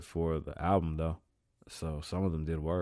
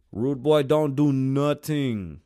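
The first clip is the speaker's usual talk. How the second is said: put-on rude boy voice; 7 semitones higher, 10 dB louder, much slower than usual. Tough-guy catchphrase.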